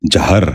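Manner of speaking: جہر said "jahr", with a after the j; short a, not i, at the start of جہر; correct pronunciation. Shown as a demonstration. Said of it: "دوبارہ سنیے".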